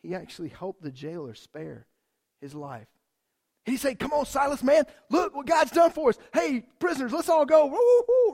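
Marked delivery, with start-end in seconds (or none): 4.0-8.3 high, loud whooping; mock excited cheer. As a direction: whoop and rally everyone out. Imagined Paul cheering freedom.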